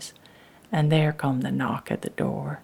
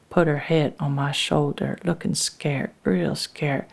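usual speaking voice

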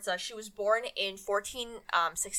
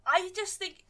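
laughing; 5 semitones higher than usual. Real, not acted. Laughing at own swearing.

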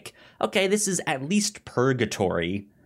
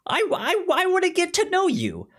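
starts plain, then climbs higher and louder into a gushing squeal; eager gushing; 4 dB louder than usual. Retold or imitated. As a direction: gush more and more, higher and louder. Quoting Ray to the little man.

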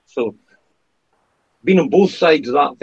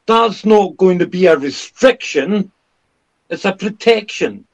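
mocking Newspeak; 5 semitones higher, 3 dB louder than usual.

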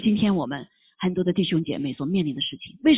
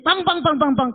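high knocking sound; 7 semitones higher than usual. Retold or imitated. Knocking comes again.

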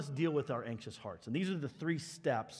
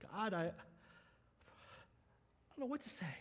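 soft, halting prayer with long pauses; anxious, lost for words; 4 semitones higher, 11 dB quieter, faster than usual. Retold or imitated. Preacher voices an anxious believer praying to God, overwhelmed and halting. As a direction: struggle to find the words, voice falling away.

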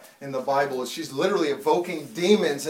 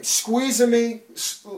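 ordinary delivery

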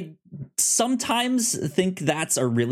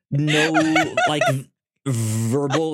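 usual speaking voice

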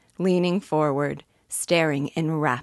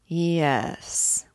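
hushed, dreamy whisper; low dreamy voice. A narrator's reading. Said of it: "in a low, dreamy voice".

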